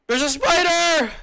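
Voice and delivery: high, loud, slow startled shriek; real fright at a spider, shrieked out; yelping at a spider in his sound foam; spider panic. Real, not acted. Spots a spider mid-recording.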